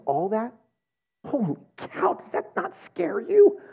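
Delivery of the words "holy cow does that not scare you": much higher, slow, squeaky fright; mock alarm. Asking the congregation, a high mock-scared squeak. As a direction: pretend to be spooked. Scared by God seeing thoughts.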